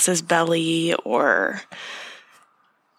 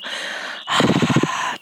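audible exhale sigh. Shown as a demonstration.

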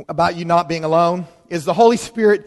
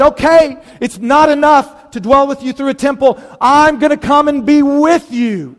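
raised, louder voice of God announcing; a lifted, louder divine voice; voicing God; 5 semitones higher, 5 dB louder than usual. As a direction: proclaim it as God stepping closer.

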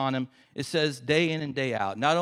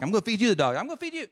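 mock squabble climbing high in pitch; bickering trash talk; 9 semitones higher, 3 dB louder, much faster than usual. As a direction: escalate a childish back-and-forth threat. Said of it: "Back and forth".

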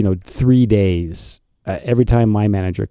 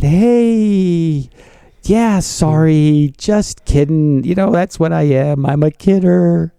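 nervous backpedal; 8 semitones higher than usual. Retold or imitated. Nervous call back to manager.

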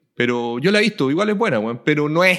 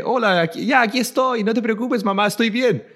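higher lightly voiced boy; a hint of a young boy on the phone; child voice imitation; 7 semitones higher than usual. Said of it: "ponía la voz del niño".

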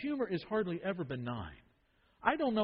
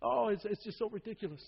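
mild mock chuckle; 3 semitones higher, 3 dB quieter than usual. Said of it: "began to kind of chuckle".

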